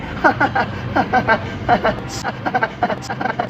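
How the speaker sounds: long, stuttering fake chuckle; forced laugh after an unconvincing denial; awkward laugh.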